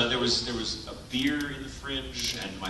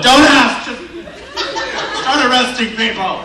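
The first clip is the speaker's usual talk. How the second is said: shrill laughing shout, much higher and louder; frantic yell; 13 semitones higher, 14 dB louder, slower than usual. Retold or imitated. To the police, a frantic laughing yell. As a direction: yell at the cops to just start arresting.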